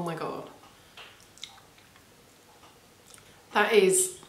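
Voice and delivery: with finger at lips; talking while tasting food. Real, not acted. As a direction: mouth with food traces. Seen 0: Licks spread off finger at 1.9-3.0s, savours while talking.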